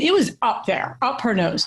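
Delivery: usual speaking voice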